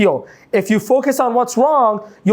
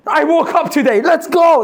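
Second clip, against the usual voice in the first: hyped up; 5 semitones higher than usual. Retold or imitated. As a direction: sell it like a motivational hype man. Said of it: "That's Sina".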